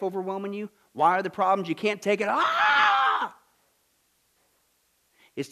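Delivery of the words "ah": high piercing yell; a sudden exclamation cry; sharp shout. Real, not acted. Shout in preaching.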